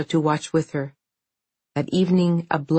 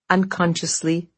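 ordinary delivery, nothing marked

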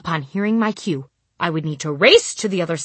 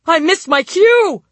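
high, loud panicked cry; 12 semitones higher, 9 dB louder, slower than usual. Retold or imitated. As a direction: panic over a missed cue. I thought.

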